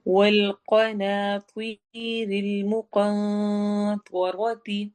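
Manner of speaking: very slow chanted tajweed recitation; teacher reciting verse for students; Quran recitation. Shown as a demonstration. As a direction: intone each syllable slowly.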